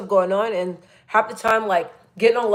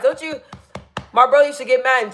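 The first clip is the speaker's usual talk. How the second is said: ordinary talk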